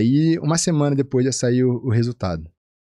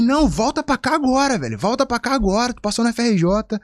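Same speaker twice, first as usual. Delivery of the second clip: high, insistent order; urging friend; 10 semitones higher than usual. Retold or imitated. Friend orders him back to Rio, high insistent voice. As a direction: come back now, mock urgency.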